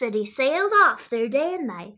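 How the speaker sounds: usual speaking voice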